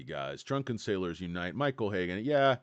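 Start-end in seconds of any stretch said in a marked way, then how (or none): none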